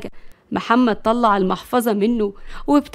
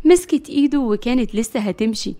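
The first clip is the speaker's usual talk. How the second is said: ordinary delivery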